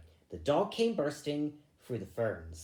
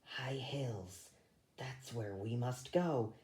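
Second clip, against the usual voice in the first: soft, breathy whisper of a seer; visionary whisper; 8 dB quieter than usual. A narrator's reading. Fiver with eyes closed telling his brother, whispering the vision. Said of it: "Fiver closed his eyes".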